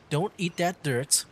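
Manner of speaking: usual speaking voice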